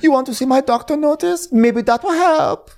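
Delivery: high-pitched put-on imitation; Diana. Host voicing Diana offering her doctor's note, mock indignant. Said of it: "she tells us".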